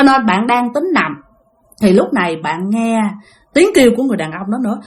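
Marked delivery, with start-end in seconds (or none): none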